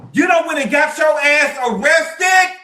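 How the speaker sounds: slow, loud calling-out shout; loud rant. Real, not acted. Ranting at Wendy on camera, a slow loud shout. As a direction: yell it at her, slow and heavy.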